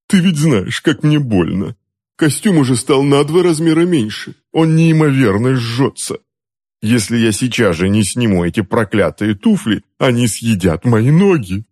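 high-pitched pained complaining; fussy, suffering husband; whining uncle. A narrator's reading. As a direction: complain as if the clothes burn you. Uncle whines in pain to wife.

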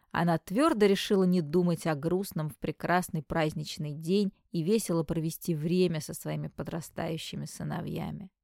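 plain reading voice

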